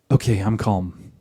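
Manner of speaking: one sighed breath, then low and soft; feigned calm. Acted. Wife claiming she is calm after one breath, feigned calm. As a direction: put on instant calm after exhaling. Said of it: "many wives just go".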